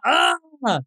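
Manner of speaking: drawn-out, high mock moan; imitated moan. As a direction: moan it out, teasing him. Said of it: "You be moaning".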